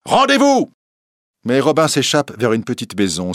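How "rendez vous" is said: appel crié, plus haut et plus fort; cri. A narrator's reading. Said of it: "crie l'évêque". (l'évêque crie le nom de Robin dans la forêt)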